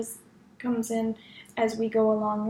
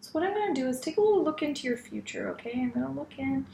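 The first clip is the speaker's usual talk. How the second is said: psychic reading; 2 semitones higher than usual. Acted. Healer looks into client's future.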